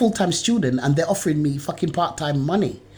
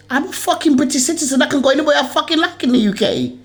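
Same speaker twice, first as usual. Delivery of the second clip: much higher and louder, indignant yelling; furious shout; 12 semitones higher, 5 dB louder than usual. Real, not acted. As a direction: shout your rights at them in real anger. Furious rant at council.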